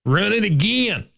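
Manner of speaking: high, drawn-out Southern coach call; hammed-up coach ordering another rep. Host mimicking a line coach at practice, Southern-accented coach impersonation. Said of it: "impersonate offensive line coaches".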